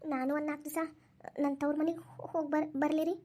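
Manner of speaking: soft, halting, afraid to ask; timid request. Acted. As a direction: hesitantly ask permission, stumbling at the end. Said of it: "ಇಷ್ಟು ಹೆದರ್ಕೊಂತ ಕೇಳ್ಬೇಕವ".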